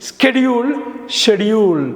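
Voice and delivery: ஸ்கெடியூல் first with 'sk', then with 'sh', slowly; both pronunciations in turn. Shown as a demonstration. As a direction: stretch out SKED-yool, pause, SHED-yool. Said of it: "அமெரிக்கால ஸ்கெடியூல்". (ஸ்கெடியூல், ஷெடியூல் - மெதுவாக இரு உச்சரிப்பு)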